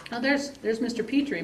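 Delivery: ordinary talk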